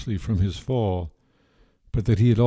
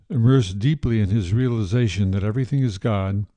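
usual speaking voice

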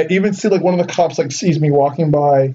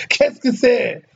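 lightly voiced, higher French Canadian; a hint of a flustered Quebecois man; Quebecois French guy; 6 semitones higher, slower than usual. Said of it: "first thing I hear out of this guy's mouth".

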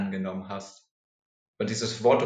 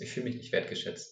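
hushed hurt; 8 dB quieter than usual. Real, not acted. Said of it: "so trotzig und wütend".

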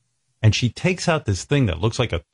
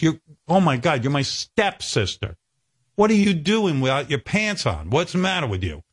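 imitating a shocked stepbrother, pitched higher; mock shocked stepbrother; 6 semitones higher than usual. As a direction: act lightly scandalized at the sight. Stepbrother shocked by naked stepsister.

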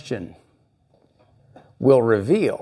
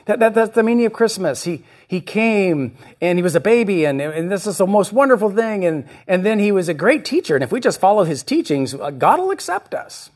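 high, gushing mock voice, higher throughout; naive believer; 7 semitones higher than usual. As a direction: parody a sweet shallow Christmas faith. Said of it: "Some say".

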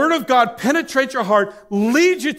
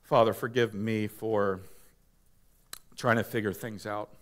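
soft prayer; 11 semitones lower, 10 dB quieter than usual. Real, not acted. Leading prayer.

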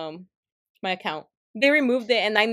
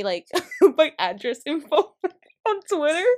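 laughing; 5 semitones higher, 5 dB louder than usual. Real, not acted. Laughing while retelling scare.

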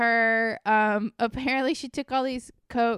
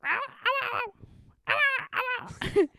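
very high-pitched yappy imitation; mock angry dog barks; angry little dog; 14 semitones higher than usual. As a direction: yap in a tiny squeak. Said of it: "this, like, angry little dog that goes".

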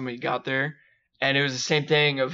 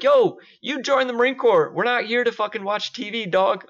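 retold chewing-out; 8 semitones higher than usual. The speaker scolding a junior Marine over TV, raised voice. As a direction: bark it at the slacker.

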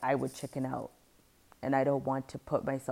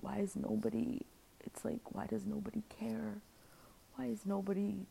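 higher, half-whispered pleading; anguished plea; 6 semitones higher, 5 dB quieter than usual. Retold or imitated. To her family, a high, hushed plea.

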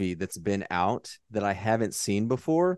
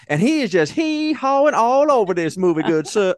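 a hammed-up Confederate gentleman, much higher and louder; mock Southern gentleman; 11 semitones higher, 7 dB louder than usual. Host mocks Branagh's villain for the co-host in a hammy Southern voice. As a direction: lampoon a pompous Southern general.

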